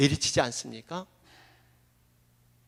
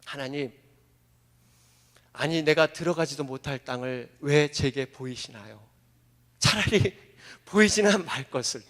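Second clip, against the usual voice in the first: quiet lament; 8 semitones lower, 5 dB quieter than usual. Acted. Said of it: "아마 이러지 않았을까요".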